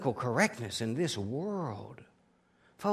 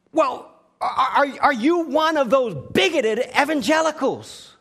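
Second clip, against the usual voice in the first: louder, higher, retold angry challenge; mad questioning; 10 semitones higher, 11 dB louder than usual. Woman confronting Huckabee, mildly mocked angry challenge. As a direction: imitate an irritated woman pressing him. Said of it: "And she got mad".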